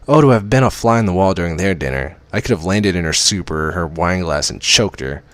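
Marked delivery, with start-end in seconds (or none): none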